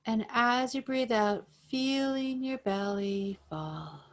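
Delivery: calm, even breathing-guide voice; guided meditation. Acted. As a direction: pace each cue with the breath, unhurried.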